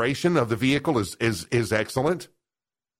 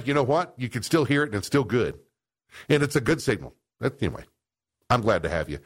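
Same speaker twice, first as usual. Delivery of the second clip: ordinary delivery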